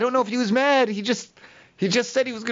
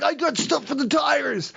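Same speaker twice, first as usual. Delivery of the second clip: imitating the guy; 8 semitones higher, slower than usual. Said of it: "he's like".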